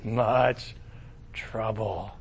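low, soft, slow despair; lightly hammed-up doom; mock dread. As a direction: moan it in mock dismay. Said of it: "He's like".

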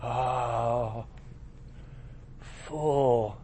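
low, soft, slow mock lament; lightly hammed-up regret; mock dismay. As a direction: groan it like a ruined king. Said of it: "Nebuchadnezzar's like".

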